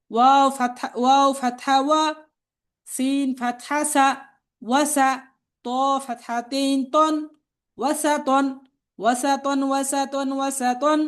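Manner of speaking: spelling drill recitation. Shown as a demonstration. Teacher spelling out Arabic words for learners to repeat, chanted drill.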